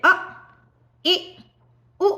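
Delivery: अ, इ, उ each brief and separate; short vowels. Shown as a demonstration. Teacher lists short vowels for students, clipped अ, इ, उ. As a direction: cut अ, इ, उ off quickly. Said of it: "ह्रस्व स्वर हैं जो छोटे वाले हैं".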